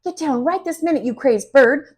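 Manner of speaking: high, loud panicked shriek; frantic high yelling at the bird. A narrator's reading. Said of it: "Missus Green shrieks".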